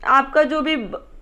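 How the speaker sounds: normal talking voice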